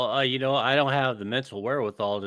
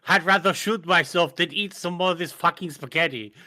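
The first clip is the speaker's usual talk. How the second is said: louder, higher mock Hitler voice; Hitler impression; 5 semitones higher, 5 dB louder than usual. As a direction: ham up the dictator rant. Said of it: "That was my Hitler impression".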